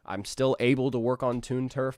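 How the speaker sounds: ordinary delivery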